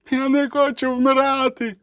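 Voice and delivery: high, drawn-out wail of a wounded man; screaming in terror. A narrator's reading. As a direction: wail desperately at high pitch. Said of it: "дер горлянку хтось із притомних поранених". (відчайдушний зойк пораненого)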